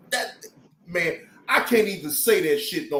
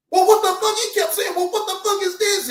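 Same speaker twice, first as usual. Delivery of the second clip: much higher, louder, hammed-up outrage; mock furious arguer; 13 semitones higher, 3 dB louder than usual. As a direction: play the man losing it over water. Imitating the arguing man.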